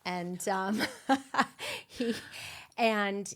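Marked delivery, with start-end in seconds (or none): 0.8-1.8 a quick breathy laugh, pitched up; short real laugh. Real, not acted. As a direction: let a little laugh escape at your own joke. Laughs at her own joke.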